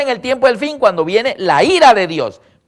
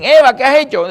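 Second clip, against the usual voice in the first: higher, louder stern demand; stern call; 8 semitones higher, 8 dB louder than usual. Retold or imitated. God confronting Eve, high loud stern question. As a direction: call her out sharply.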